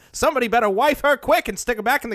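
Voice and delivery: put-on fast, high old-timey chauvinist. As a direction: rattle it off like a 1950s man. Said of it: "anytime that voice starts".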